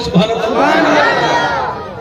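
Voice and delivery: long, stretched out call of praise; drawn-out call. Shown as a demonstration. Preacher leading the crowd's response, long and stretched. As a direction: lead the crowd, drawing it out. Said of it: "ذرا بولیں".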